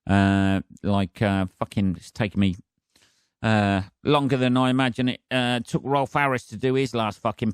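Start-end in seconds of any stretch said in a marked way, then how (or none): none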